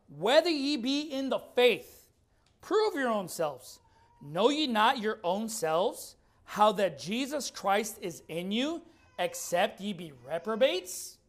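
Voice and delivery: slow, measured scripture reading. Shown as a demonstration. Preacher reading Paul's verse to the congregation, slowly. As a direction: draw each phrase of the verse out. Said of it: "Let's read it slowly".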